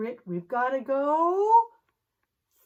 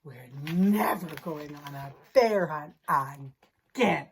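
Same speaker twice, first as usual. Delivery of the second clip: relieved vow; 10 semitones lower than usual. Pretend. Safe in bed, relieved ending.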